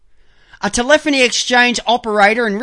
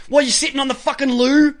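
ordinary talk, nothing marked

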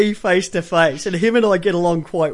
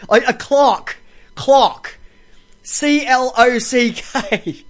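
clock repeated alone, higher and firm, then C L O C K spelled slowly; emphatic clarification; 3 semitones higher, much slower than usual. Shown as a demonstration. Host correcting a misheard word, emphatic and deliberate.

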